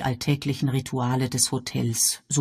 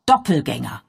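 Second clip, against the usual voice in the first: triumphierend ausgerufen; Doppelgänger! als Geistesblitz rufen; Ausruf; 4 dB louder than usual. Acted. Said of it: "rief Miss Marple aus". (Miss Marple fällt das Wort ein, ausrufend)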